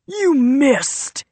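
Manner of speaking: loud, drawn-out gloating hiss; hissed jeer. A narrator's reading. Royal to Nola after her shot missed, hissed jeer. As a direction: jeer it hard, pitch leaping on the last words. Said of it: "he hissed".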